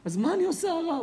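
acted high-pitched desperate question; desperate plea. Mother asks the rabbi what to do, desperate plea. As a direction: plead upward as a mother at a loss.

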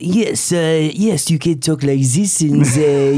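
hammed-up French accent; mock French, playfully overdone. Shown as a demonstration. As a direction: put on a jokey French accent. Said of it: "that was my French winker accent".

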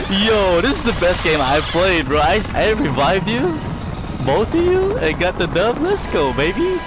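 loud, high victory celebration; excited. Real, not acted. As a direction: celebrate like you just won. Celebrating the win loudly.

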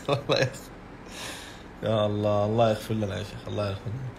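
can't hold back the laughter; laughing. Real, not acted. Preacher laughing at a joking commenter, words half lost in laughter.

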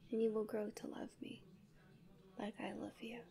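fading possessive whisper. Acted. Angel confessing love to her sleeping human, fading whisper. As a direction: let it dissolve into breath.

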